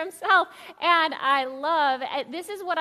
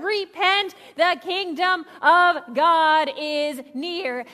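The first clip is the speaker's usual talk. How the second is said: mild shout; 4 dB louder, much slower than usual. Retold or imitated. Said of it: "He shouted".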